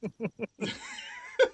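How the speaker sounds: squeaky burst of laughter; a quick squealing laugh; high-pitched laugh. Real, not acted. High laugh after joke.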